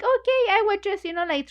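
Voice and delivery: high, quick startled "Okay", caught off guard; flustered okay. Retold or imitated. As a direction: blurt it out like someone caught daydreaming. Juliet caught daydreaming, flustered.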